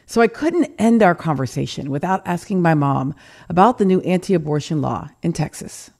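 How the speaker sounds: ordinary delivery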